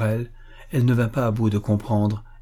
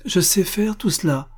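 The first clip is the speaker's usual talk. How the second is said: proud; 9 semitones higher than usual. A narrator's reading. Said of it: "en redressant fièrement la tête".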